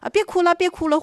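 quick, high-pitched placating; mock coaxing. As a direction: imitate someone simply coaxing a crying toddler. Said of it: "这个简单的这个哄啊、 劝呐".

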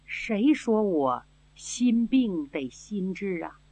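slow, soft and weak; frail slow voice. Acted. Sick old princess questioning the girl, frail and slow. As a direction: speak as if you barely have the strength.

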